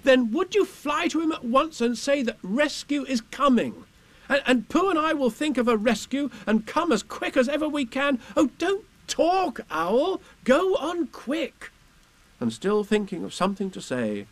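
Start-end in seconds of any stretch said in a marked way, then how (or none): 0.0-11.7 put-on high eager boy's voice; a young boy pleading with Owl; urgent Christopher Robin. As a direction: rush to the rescue like a small boy.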